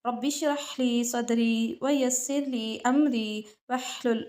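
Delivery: slow, devout Arabic recitation; opening recitation. Real, not acted. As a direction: recite the opening prayer reverently. (धीमी इबादत वाली तिलावत)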